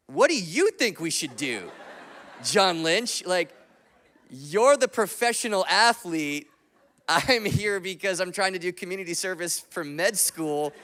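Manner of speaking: loud, high-pitched mock exasperation; incredulous protest. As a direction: protest loudly to the pro, playing up the disbelief. Acts out his incredulous inner protest.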